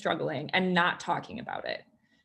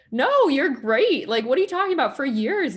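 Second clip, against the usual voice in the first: emphatic insistence; 6 semitones higher, 6 dB louder than usual. Retold or imitated. Telling a friend she's great, loud emphatic insistence. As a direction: insist she really is great, almost exclaiming. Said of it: "And I was like".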